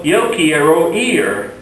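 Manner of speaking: slow, clear Spanish for learners; slow Spanish model. Shown as a demonstration. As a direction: say it slowly so students can repeat. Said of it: "if I want to say".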